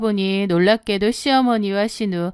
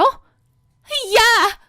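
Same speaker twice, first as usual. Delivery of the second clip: sharp high yell; mock yell; 12 semitones higher than usual. Sister-in-law yelling over the phone, high and sharp. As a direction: bark her name in anger.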